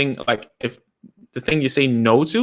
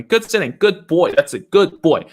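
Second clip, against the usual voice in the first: put-on higher, louder praise for a child; emphatic praise; 5 semitones higher, 4 dB louder than usual. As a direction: praise him like a proud parent. Keep on praising him.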